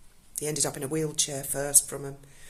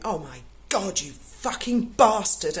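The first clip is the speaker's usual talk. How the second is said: angry curse; 4 semitones higher, 5 dB louder, slower than usual. Retold or imitated. Instructors cursing the man.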